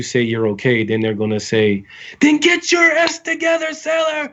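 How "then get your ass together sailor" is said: high-pitched hammed-up bark of a drill instructor; screaming drill instructor. Drill instructor yelling at a crying recruit, high mock shout. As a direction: scream it like a drill sergeant ending the pep talk. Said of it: "Like, something like that".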